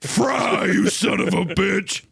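high, drawn-out mock action-hero yell; one-liner shout. Game hero as the weapon shoots, mock shout. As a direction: stretch it out like a hammy game hero. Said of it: "say some line".

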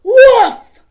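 loud, high barking woof; dog bark. Acted.

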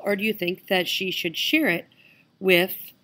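ordinary delivery

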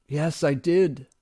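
slow, miserable admission. A narrator's reading. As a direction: drag the words out in misery. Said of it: "Eurylochus said miserably".

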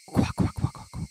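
whispered quacking cicada call; bad cicada impression; imitated cicada chirp. Shown as a demonstration. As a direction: fake the insect chirp under your breath. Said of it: "I can't do a good, uh, cicada".

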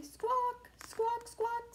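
high squawking parrot call. Shown as a demonstration. Teacher reading to kids, high parrot squawk. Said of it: "Can you do that with me".

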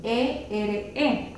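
letters "E R E" drawn out slowly; slow spelling. Shown as a demonstration. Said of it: "la siguiente combinación es". ("E R E" deletreado despacio)

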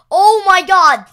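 loud, high delighted cry; thrilled the crops grew; excited. Real, not acted.

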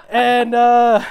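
ordinary talk

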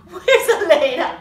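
ordinary talk, nothing marked

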